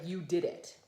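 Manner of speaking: ordinary delivery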